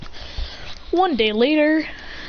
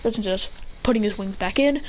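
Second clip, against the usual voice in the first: plain reading voice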